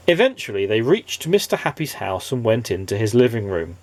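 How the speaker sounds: plain reading voice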